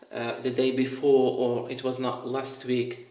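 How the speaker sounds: ordinary talk, nothing marked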